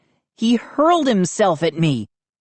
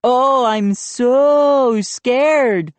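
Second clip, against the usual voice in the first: very slow, mocking sarcasm; sarcastic; 6 semitones higher, 3 dB louder, much slower than usual. A narrator's reading. Drawn-out sarcastic taunt. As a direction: Joe mocks Mindy's threat, sarcastic. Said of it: "Joe mocks Mindy's threat".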